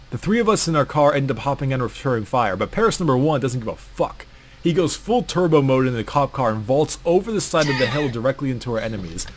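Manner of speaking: ordinary delivery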